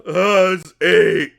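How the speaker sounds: high, loud, slow ogre bellow; laughing ogre. Acted. Ogre heard as the bet goes in, gleeful bellow. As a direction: boom it out slowly like a gleeful ogre. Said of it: "You hear the, you hear the, um, ogre laugh".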